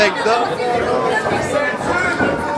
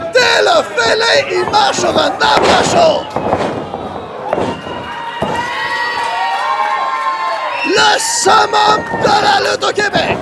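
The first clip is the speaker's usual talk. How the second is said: excited high play-by-play shouting; wrestling commentator; 8 semitones higher than usual. Acted. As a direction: call every move like a ringside hype man.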